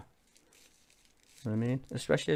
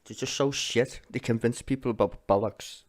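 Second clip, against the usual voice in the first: usual speaking voice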